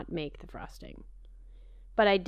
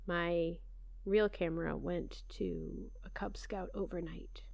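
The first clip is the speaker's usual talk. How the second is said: whispered aside; 9 dB quieter than usual. Real, not acted. Muttered aside.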